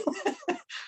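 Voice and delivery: short high squeal of a laugh; high giggle. Real, not acted. Teacher keeps giggling at her own joke, high laugh. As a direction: let the giggle bubble up.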